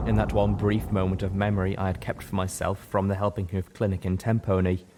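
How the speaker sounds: usual speaking voice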